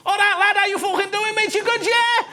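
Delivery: high, loud Scouse cabbie; Scouse taxi driver. Acted. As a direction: play a hammed-up Scouse driver. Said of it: "this Scouse taxi driver was like".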